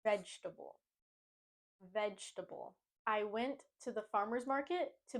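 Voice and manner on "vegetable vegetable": vegetable as VEJ-tuh-bull; three-syllable vegetable. Shown as a demonstration. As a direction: say vegetable without a fourth syllable. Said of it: "but we just wanna say".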